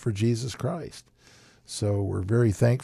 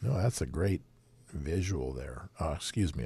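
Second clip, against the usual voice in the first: ordinary talk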